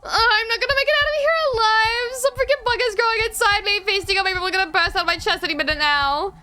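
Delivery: high, loud panic; frantic, on the edge of hysteria; panicked. Acted. Panic over bug inside.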